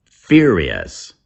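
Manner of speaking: ordinary talk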